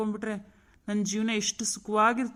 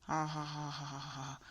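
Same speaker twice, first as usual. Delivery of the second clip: low slow chuckle; 6 semitones lower, 10 dB quieter, much slower than usual. Acted. Man pleased at her happy face.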